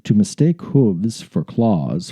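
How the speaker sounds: ordinary talk, nothing marked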